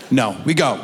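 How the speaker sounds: ordinary talk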